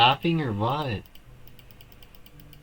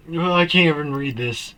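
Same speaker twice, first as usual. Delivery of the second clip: usual speaking voice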